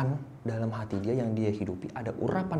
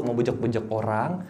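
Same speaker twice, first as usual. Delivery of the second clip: normal talking voice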